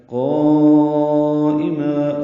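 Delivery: قائمہ chanted with the first vowel held very long and loud; long madd. Shown as a demonstration. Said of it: "پانچ یا چھ حرکات لمبا کرتے ہیں".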